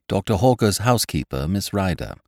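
plain reading voice

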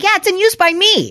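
high, sarcastic quip at the machine; mock-bright comeback to the charger screen; snarky retort. Talking back to the charger, high and sarcastic. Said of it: "I'm like".

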